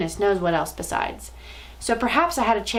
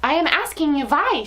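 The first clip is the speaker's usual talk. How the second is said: put-on much higher, demanding foreign-accented cry; accented scream; 7 semitones higher, 3 dB louder than usual. Grand High Witch demands answers, higher accented scream. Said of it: "she screamed".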